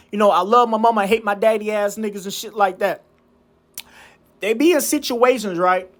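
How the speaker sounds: ordinary talk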